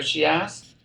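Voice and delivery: ordinary delivery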